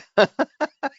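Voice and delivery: short high laugh; high amused laugh. Real, not acted. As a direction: laugh in surprise. Reacting to chat comments.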